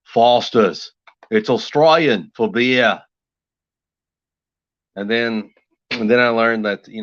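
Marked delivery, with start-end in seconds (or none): 0.1-3.0 slow mock-Australian ad tagline; Australian beer commercial. Imitating the guy in a Foster's commercial, slow Australian accent. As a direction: imitate an Aussie beer advert, drawing it out. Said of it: "beer commercial for Foster's".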